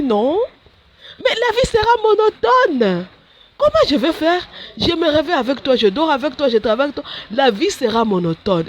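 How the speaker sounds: high whiny protest; whiny complaint. Retold or imitated. Nun complaining to Jesus about a dull life, high and whiny. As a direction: complain like a reluctant child.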